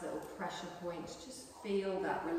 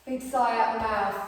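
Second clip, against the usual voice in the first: louder breathing cue to the class; guided breathing cue; 4 semitones higher, 12 dB louder than usual. Acted. Yoga teacher cueing the class to sigh, guiding voice. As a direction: call the sigh out to the room.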